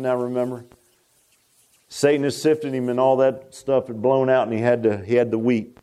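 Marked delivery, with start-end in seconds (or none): none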